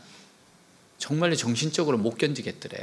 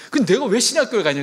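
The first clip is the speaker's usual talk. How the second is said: defiant retort; 7 semitones higher, 6 dB louder, faster than usual. Retold or imitated. Son retorts to father.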